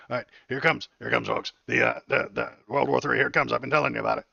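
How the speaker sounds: loud mock hype, rising toward World War Three. Streamer winds up chat for the clip, loud mock hype. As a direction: boom it out like a carnival barker teasing doom. Hyping up the clip's war talk.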